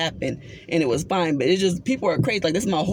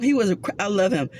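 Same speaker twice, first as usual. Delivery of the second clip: normal talking voice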